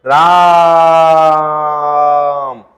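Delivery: "राम" with the आ held very long and low; pluta vowel. Shown as a demonstration. Dying Dasharath calling Ram, vowel drawn out. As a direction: draw out "राम" slowly as a dying call. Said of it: "तो जब ऐसे आवाज को खींचा हमने".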